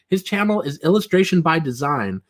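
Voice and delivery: usual speaking voice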